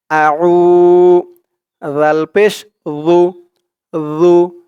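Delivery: জু said soft and light, tongue tip to upper teeth; slow, drawn syllables আউ and জু; soft thin জু. Shown as a demonstration. Teacher shows students the soft জু, slow. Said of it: "জালের উচ্চারণটা একদম নরম পাতলা হবে".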